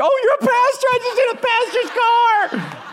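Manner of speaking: very high, loud mock wail of a distraught girl; hammed-up horrified meltdown; hysterical. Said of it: "she's hysterical about it".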